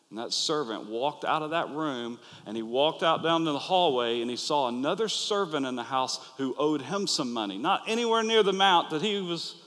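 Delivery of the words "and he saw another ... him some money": usual speaking voice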